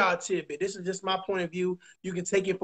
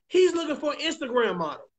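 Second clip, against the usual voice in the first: ordinary talk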